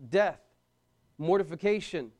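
ordinary talk

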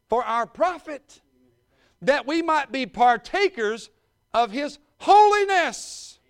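loud, high, hammering preaching; preaching at full force. Real, not acted. Preacher driving home holiness to the church, at full force.

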